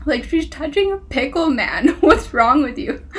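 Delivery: laughing disbelief at the hero; mock exasperation. Real, not acted. Reader scolding the book's hero, laughing exasperation. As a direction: scold the character through laughter. Mocking the character.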